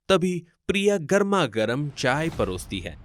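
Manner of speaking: ordinary delivery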